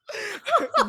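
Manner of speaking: a sudden high loud laugh; burst of laughter. Real, not acted. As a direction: laugh out at the memory. Laughs at own comeback.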